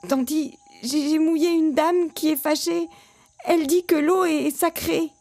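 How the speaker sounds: put-on high, frightened child's confession; stammering boy. A narrator's reading. Scared boy confessing to his aunt, stammering. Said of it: "Ange-Marie bredouille".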